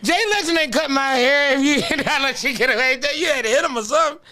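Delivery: high-pitched through laughter; real laughing, voice jumping up; laughing hard. Real, not acted. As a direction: squeal it out while cracking up. Riffing while cracking up at friend.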